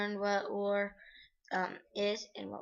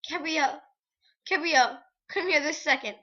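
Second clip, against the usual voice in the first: urgent call; 7 semitones higher, 11 dB louder than usual. Acted. Mother calling her daughter.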